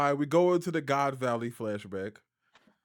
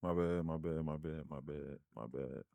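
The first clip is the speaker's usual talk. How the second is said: softer mumbled apology; quiet; 11 semitones lower, 14 dB quieter than usual. Real, not acted. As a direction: trail off, repeating it softer.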